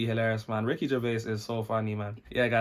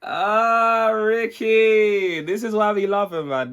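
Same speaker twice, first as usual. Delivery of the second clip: louder, higher burst of delight; excited exclamation; 8 semitones higher, 7 dB louder, much slower than usual. Real, not acted. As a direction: cry out his name in delight. Reacting to joke punchline.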